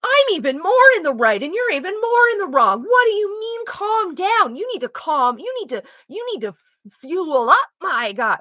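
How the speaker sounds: high, loud, outraged mock rant; exasperated high indignant voice, turning on the other person; indignant outrage. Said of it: "amps up the".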